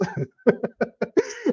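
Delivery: high breathy laugh. Real, not acted. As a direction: laugh at the absurdity. Laugh at insanity.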